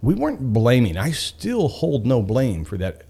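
ordinary talk

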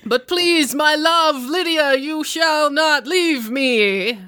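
high, melodramatic lover's plea; hammed-up swashbuckling heartbreak; Richard. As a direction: plead grandly, drawing out the last words. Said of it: "Richard says".

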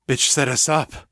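stunned. A narrator's reading. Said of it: "sounding stunned".

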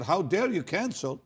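high-pitched, indignant retold outburst; retold anger. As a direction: imitate an angry, incredulous protest. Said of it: "He got very angry".

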